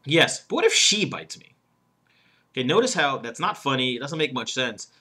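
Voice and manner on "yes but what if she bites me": "she" punched high and loud; stress on she. Shown as a demonstration. Coach shows a wrong emphasis that isn't funny, stress on "she". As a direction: break after "yes", then hit "she" hard. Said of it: "emphasis on she".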